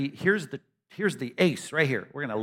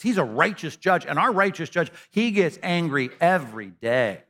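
higher, louder preaching voice; 4 semitones higher, 5 dB louder than usual. Acted. Preacher proclaims to the congregation, full force. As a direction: preach it with conviction.

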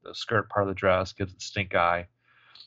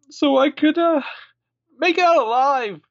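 high, loud hammed-up damsel wail; mock melodramatic victim; 6 dB louder than usual. Mocking Jenna's fake plea to the cops in a high loud hammed-up wail. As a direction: ham up the poor-victim act.

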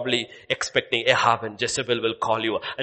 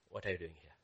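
very soft, low question; God's soft whisper; 5 semitones lower, 16 dB quieter, faster than usual. Acted. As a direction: murmur it gently like God.

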